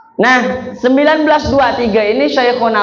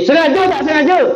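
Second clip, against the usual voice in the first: higher, more insistent Sengaja tak sengaja; pressing teacher; 8 semitones higher than usual. Retold or imitated. Kiai Khalil asking again, more insistent. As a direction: press the pupil harder.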